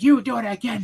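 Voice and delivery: high, loud teacher threat; mock teacher yell. Teacher threatening a pupil, angry shout. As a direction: snap the order at the kid. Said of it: "she's like".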